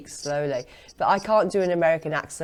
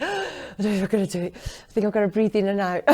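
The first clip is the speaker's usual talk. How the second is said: rushed, laughing retelling of nerves; mock panic, words tumbling out fast; nervous; 2 semitones higher, faster than usual. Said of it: "I was nervous enough doing this".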